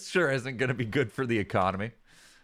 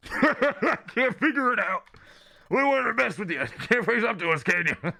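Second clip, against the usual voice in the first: raised, laughing sneer; mocking bully laughing; 4 dB louder than usual. Bully Pokemon laughing at Mudkip to provoke it, raised and sneering. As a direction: taunt the little one with cackling.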